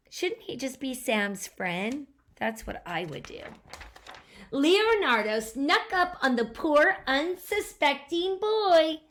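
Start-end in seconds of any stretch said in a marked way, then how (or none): 4.5-9.0 put-on lively read-aloud to a child; storybook narration. A narrator's reading. Parent reading a picture book to a child, animated narration. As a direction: tell the story with bounce.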